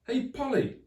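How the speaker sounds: high loud call over noise; shout over engine. Acted. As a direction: shout above the roar. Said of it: "shouted Friday over the noise".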